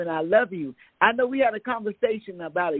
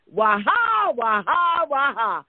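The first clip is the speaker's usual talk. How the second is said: joyful whoop; 5 semitones higher, slower than usual. Real, not acted. Joyful cheer to caller.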